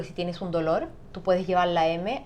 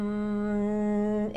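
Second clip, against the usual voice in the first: long steady hum in a comfortable tone; gentle M hum; 2 semitones higher than usual. Shown as a demonstration. (zumbido suave y cómodo de la M)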